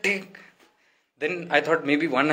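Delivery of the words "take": mock angry command. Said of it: "He pulled it back".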